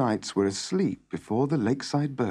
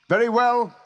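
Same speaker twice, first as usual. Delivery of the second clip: high, loud, resolute call; raised voice, measured phrases; 10 semitones higher, 5 dB louder than usual. A narrator's reading. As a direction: call back your answer to the challenge. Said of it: "He called out".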